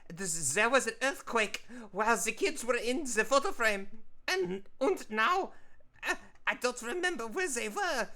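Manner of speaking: high, loud, frantic cartoon voice in a hammed-up bad accent; panicky photo frame. Artist voicing Franz the picture frame, panicked and high. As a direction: wail it like a frame that lost its kids. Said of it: "my bad accent aside".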